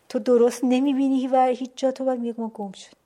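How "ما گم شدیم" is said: grim quote. Retold or imitated. Guide admits they are lost.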